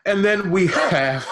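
giggling through the words; laughing. Real, not acted. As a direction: laugh while speaking. Laughs mid-sentence at own joke.

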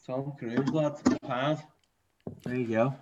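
quiet, slurred mumble. Real, not acted. Drunk friend replying under his breath, quiet mumble. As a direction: mumble it half to yourself.

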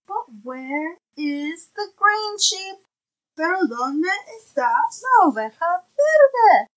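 bright, clear picture-book reading; reading to a toddler. Acted. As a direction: read it aloud to a little one. Parent reads picture book aloud.

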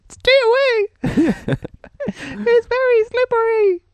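very high, slow squeaky voice. Pretend. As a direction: squeak it out like a silly cartoon monster. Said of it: "Should give him the squeaky voice".

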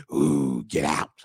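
low, drawn-out ghostly moan. Pretend. Ghost scaring people out of the house, low moaning. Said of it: "Ghosts say stuff like".

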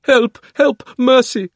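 very high, loud desperate cry; desperate plea. Acted. The witch crying after the fleeing children, desperate. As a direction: beg for mercy in terror. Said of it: "cried the witch in a faint voice".

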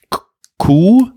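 hoch und laut ungläubig; Kuh? hell herausgerufen; überrascht. Real, not acted. Startled high outcry. (verblüffter Ausruf)

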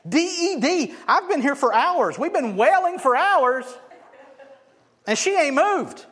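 loud, high-pitched scornful protest; jeering mourners. Acted. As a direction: shout him down in disbelief. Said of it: "And they laughed".

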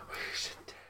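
breathy perfume ad whisper. Pretend. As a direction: whisper it seductively like an ad.

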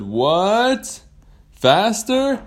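high, loud questioning shout; mishearing. Acted. Whale mishearing its rider, loud puzzled question. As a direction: call back as if you misheard.

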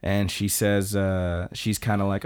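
ordinary delivery

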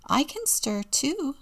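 put-on high, drawn-out small child voice; eager little helper, pitched high; little boy's voice. A narrator's reading. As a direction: pipe up slowly like a small boy. Story reader voices little Robbie.

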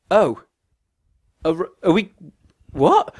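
high, halting bafflement; stumble, then jump up in pitch on "what"; acted puzzled stammer with pauses; mock confusion. Retold confusion about the date.

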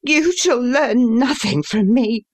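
high-pitched, impassioned refusal; passionate defiance. A narrator's reading. Son refusing to tell his father, passionate defiance. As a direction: voice the son high, flaring up in anger. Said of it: "with a passion".

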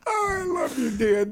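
mock weepy. Mock tearful tribute to dad.

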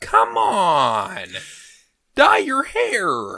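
high, slow drawn-out whine; whiny complaint. Real, not acted. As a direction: whine it like a fed-up fan. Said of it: "I hate to sound like a little whiny bitch".

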